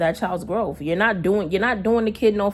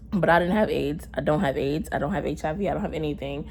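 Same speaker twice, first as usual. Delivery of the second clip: ordinary talk, nothing marked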